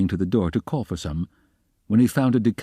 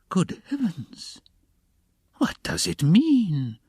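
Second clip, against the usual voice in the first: high, slow, aghast exclamation; shocked, high-pitched dismay; frightened cry; 11 semitones higher, much slower than usual. A narrator's reading. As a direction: gasp it out in drawn-out alarm. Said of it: "cried Porfiry Petrovitch, apparently quite frightened".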